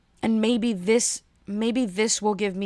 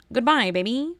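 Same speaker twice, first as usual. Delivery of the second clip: a higher, half-hearted Kermit goodbye; a weak stab at Kermit with a rising end; 4 semitones higher, 3 dB louder than usual. Acted. Signing off to listeners in a loose Kermit voice. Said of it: "that didn't really sound like Kermit".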